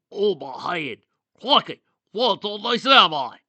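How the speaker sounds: loud, high-pitched Australian monster voice; Australian accent. A narrator's reading. Dazed monster just summoned, exclaiming in an Australian accent. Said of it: "speaking in an Australian accent".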